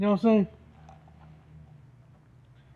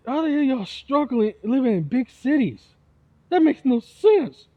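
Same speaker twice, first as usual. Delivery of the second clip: retold exasperated yell; 8 semitones higher, 4 dB louder than usual. Berating people from big cities who struggle, exasperated yell. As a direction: demand an answer, baffled and angry.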